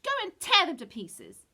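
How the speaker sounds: loud, high witch screech; screeching command. A narrator's reading. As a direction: scream the order at the wolves. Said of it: "she screeched".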